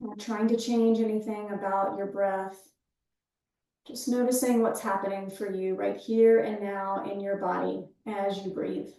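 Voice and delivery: calm breath-awareness cueing, easing down at phrase ends; guided meditation. Real, not acted. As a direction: guide them gently into the breath, leaving space after each phrase.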